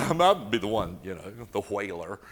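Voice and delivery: laughing through the words. Real, not acted. Admitting to the audience he's a big crier, laughing. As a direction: giggle out the confession.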